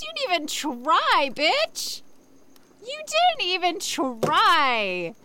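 high-pitched indignant rant; outraged. Real, not acted. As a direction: shriek in disbelief at the ripoff.

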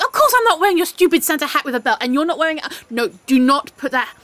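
loud, fast, high-pitched scolding; rapid-fire loud telling-off; exasperated outburst. Acted. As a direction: burst out indignantly at a friend. Scolding friend over Santa hat.

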